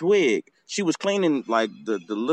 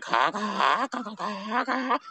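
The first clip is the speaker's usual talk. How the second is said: rapid burst of overlapping caws, climbing; crows cawing together; 7 semitones higher, 4 dB quieter, much slower than usual. Shown as a demonstration. Two crows come back together against the hawk, frantic caws. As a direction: chatter like two crows ganging up. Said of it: "was like".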